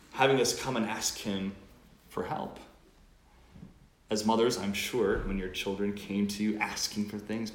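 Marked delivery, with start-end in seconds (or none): none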